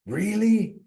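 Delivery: high, scoffing "really?"; mock the idea with disbelief; sarcastic disbelief. Real, not acted. Mocking gullible insureds again.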